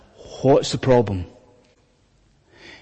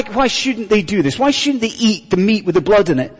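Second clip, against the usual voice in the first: usual speaking voice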